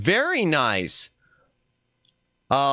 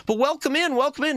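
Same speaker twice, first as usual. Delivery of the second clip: cute anime streamer; 10 semitones higher, faster than usual. Acted. Greeting raiders in streamer persona.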